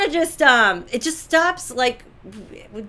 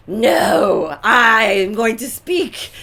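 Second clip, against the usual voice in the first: louder, slow, insisting on having the floor; assertive alpha; 7 dB louder, much slower than usual. Retold or imitated. Alpha female refusing to back down in an argument, loud and slow. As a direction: plant your feet and claim the floor. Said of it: "it's in my genes to be like".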